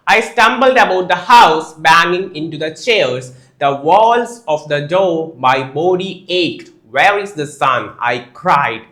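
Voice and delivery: slow, projected teacher's reading; story read-aloud. A narrator's reading. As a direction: read it out phrase by phrase, very slowly. Reads the story passage aloud. (പതുക്കെ ഉറക്കെയുള്ള കഥാവായന)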